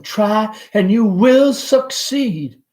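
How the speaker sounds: mother urging her son, enthusiastic; great enthusiasm. Acted. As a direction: cheer him on with fervour. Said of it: "with great enthusiasm".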